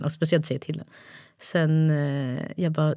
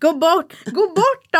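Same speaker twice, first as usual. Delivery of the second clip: high-pitched, loud repeated order; retold shouting; 10 semitones higher, 5 dB louder, much slower than usual. Mum retelling orders to children who ignore her, shouting. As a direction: mum at the end of her patience, imitated yelling. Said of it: "Gud, vad mycket du skriker".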